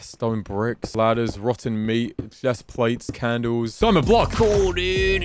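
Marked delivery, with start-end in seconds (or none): 3.8-5.2 high, hyped gamer bro. Shown as a demonstration. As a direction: pump it up like a hyped streamer. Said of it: "That's my gamer voice".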